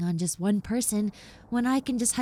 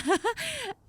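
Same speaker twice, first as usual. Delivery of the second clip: bright embarrassed giggle, much higher; shy laugh; 14 semitones higher than usual. Acted. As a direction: giggle at yourself for talking too much. Shy giggle after rambling.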